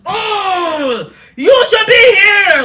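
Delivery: loud, high, drawn-out cry of protest; upset wailing shout. Acted. Daughter protesting to her late dad, upset wailing shout. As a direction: wail it out in hammed-up anguish. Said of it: "I get so upset".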